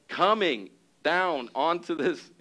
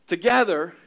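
ordinary delivery

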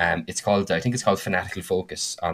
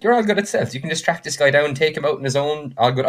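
breezy assurance; 7 semitones higher than usual. Retold or imitated. DM recalls his expectation.

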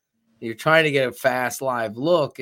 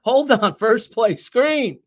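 mock play call; 9 semitones higher, 6 dB louder, slower than usual.

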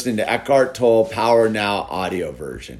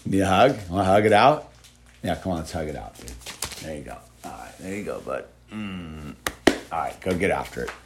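gentle; 8 semitones lower, 6 dB quieter, faster than usual. Real, not acted. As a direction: soothe him kindly. Soothing the kid after scolding.

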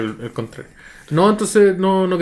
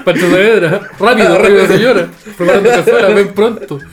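loud, higher call, breaking into laughter; mock lady calling out; 5 semitones higher, 8 dB louder than usual. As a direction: yell for him through giggles like a frantic cook. Acting out a lady calling Pancho. (llamada fingida a gritos, entre risas)

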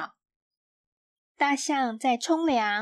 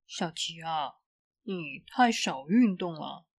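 lower, gentle dad voice; father's voice; 7 semitones lower, 3 dB quieter than usual. A narrator's reading. Dad tells Xiaoqi she exercises too little, lower fatherly voice. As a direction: speak as a kind father advising. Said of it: "爸爸说".

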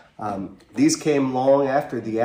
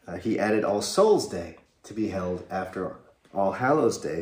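ordinary delivery

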